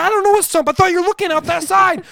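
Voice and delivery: high, loud argumentative protest; retold defensive yelling. Driver acts out blaming the lookout passenger, defensive yell. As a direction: argue back at the top of your voice.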